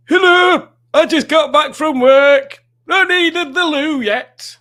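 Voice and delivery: very high, loud, slow squeaky impression; voice for Alex. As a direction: shriek it out high and slow like a cartoon. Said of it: "let me do a voice for Alex".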